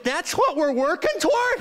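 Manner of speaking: high-pitched mock outrage; hammed-up incredulous protest; appalled disbelief. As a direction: squeal the question in comic horror. Appalled reply to trainer's promise.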